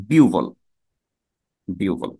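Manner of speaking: বিউভল said as biu-vol, h turned to u; biu-vol, falling at the end; correct pronunciation. Shown as a demonstration. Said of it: "সেটি হলো এটি".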